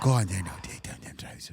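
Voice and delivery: low Louth murmur sinking to whisper; mock-seductive Louth ASMR, deep then whispered; Louth accent. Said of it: "You have to do in a loud accent".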